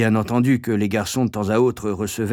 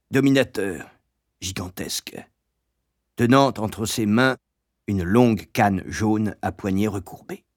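ordinary delivery, nothing marked

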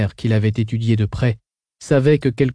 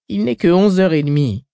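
complaining; 6 semitones higher, 4 dB louder than usual. Acted. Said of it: "se plaignit Tamalan".

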